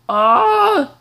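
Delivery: sudden high yelp of shock; startled yelp. Real, not acted. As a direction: yelp as the car flips. Crash in game.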